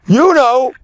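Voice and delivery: high, strained accusing snap; mock angry accusation. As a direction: throw it at him as an accusation. Angry young sailor confronts the older one.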